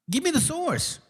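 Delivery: high, soft mock demand. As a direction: squeak it back to mock him. Repeated demand, second take mocking.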